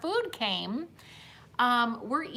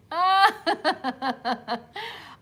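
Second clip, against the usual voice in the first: high, loud burst of laughter; 8 semitones higher, 6 dB louder than usual. Real, not acted. Laughing at the shared stories.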